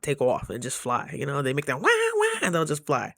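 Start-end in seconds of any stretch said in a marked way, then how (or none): 1.9-2.4 high honking goose call; mock goose honk.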